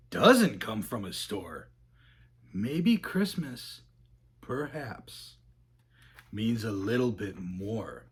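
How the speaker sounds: hushed realization. A narrator's reading. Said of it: "he thought".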